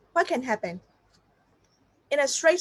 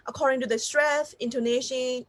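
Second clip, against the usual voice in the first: ordinary delivery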